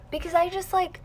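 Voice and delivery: ordinary talk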